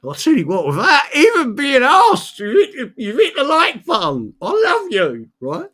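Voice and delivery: high, loud gushing praise; cheery cockney showman. Real, not acted. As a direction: gush like a showman thanking the crowd. Gushing thanks to viewers for likes.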